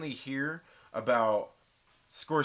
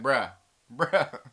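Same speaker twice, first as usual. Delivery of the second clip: self-impression; 6 dB louder than usual. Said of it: "Now you gotta be like".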